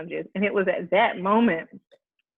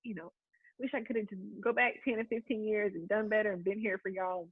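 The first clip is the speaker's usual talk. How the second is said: choked, much softer apology through tears; tearful apology; 2 semitones higher, 9 dB quieter than usual. Real, not acted. Retelling her apology to grandma, softly through real tears. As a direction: barely hold it together while apologizing. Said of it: "saying I'm sorry to her".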